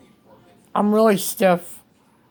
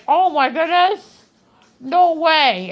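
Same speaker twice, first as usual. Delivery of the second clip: higher, louder mock astonishment; imitating amazed doctors; 7 semitones higher, 3 dB louder, slower than usual. Patient retelling the doctors' stunned reaction, mock astonishment.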